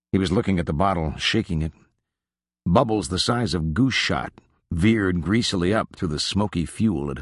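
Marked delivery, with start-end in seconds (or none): none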